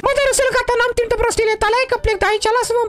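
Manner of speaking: squeaky high cartoon voice, fussing; a high, excited toy character scolding his friend. Same character, annoyed. (voce de personaj ascuțită)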